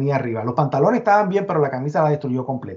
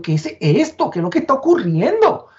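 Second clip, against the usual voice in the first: rising, stunned retold question; stunned disbelief; 8 semitones higher than usual. Survivor staring at the ruined lobby, stunned and rising. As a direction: relive the shock, jaw dropped. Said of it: "me quedo con la boca abierta diciendo".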